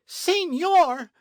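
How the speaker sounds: very high, loud outraged cry; shriek. A narrator's reading. Señorita answers captain's insult, shrieking. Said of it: "she shrieked".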